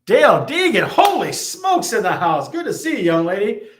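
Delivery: high, loud delighted welcome; light up as a friend shows up; excited greeting. Real, not acted. Host welcomes a regular viewer arriving in chat, delighted and loud.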